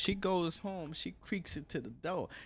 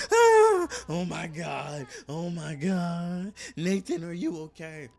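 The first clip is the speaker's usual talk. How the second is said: loud, horrified acted cry with drawn-out wails; mock screaming; 3 semitones higher, 11 dB louder, slower than usual. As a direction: act out a wife shrieking in horror. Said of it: "Michelle starts screaming".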